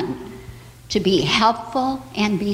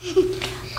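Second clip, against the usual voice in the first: giggle; 9 semitones higher, 4 dB quieter than usual. Acted. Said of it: "said the chick with a giggle".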